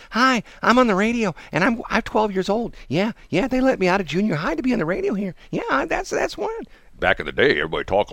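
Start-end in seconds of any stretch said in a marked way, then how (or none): 0.1-6.5 put-on very high, boyish radio voice; twelve-year-old DJ. Mocking modern DJs as kids, very high and boyish. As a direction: sound like a kid let out of junior high. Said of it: "They gotta sound like they're twelve".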